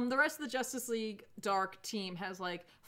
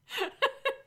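high burst of laughter; 5 dB louder than usual. Real, not acted. Cracking up at the image.

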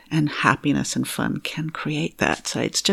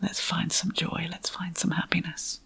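much softer, nearly voiceless coaxing; gentle whisper; 2 semitones higher, 10 dB quieter than usual. Shown as a demonstration. Coach softly encourages her clients toward joy, whispered. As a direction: whisper it like a secret gift. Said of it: "so I like to say".

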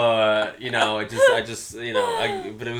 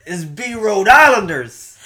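high, loud, stretched-out Rhode Island parody; mock Rhode Islander accent, proudly hollered; 10 semitones higher, 10 dB louder, slower than usual. Shown as a demonstration. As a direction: belt out a slow, hammed-up Rhode Island accent. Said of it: "that's how they sound".

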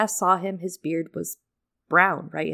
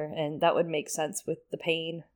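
normal talking voice

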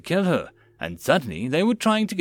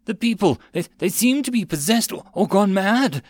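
stammering, high, breathless panic; shaken, words tumbling out; urgent panting report; 8 semitones higher, 4 dB louder than usual. A narrator's reading. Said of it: "A breathless messenger".

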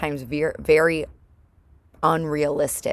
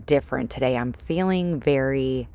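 ordinary talk, nothing marked

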